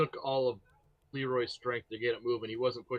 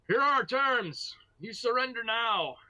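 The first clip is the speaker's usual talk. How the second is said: raised voice; 9 semitones higher than usual. Acted. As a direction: call out an ultimatum in character. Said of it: "Hear him raise his voice".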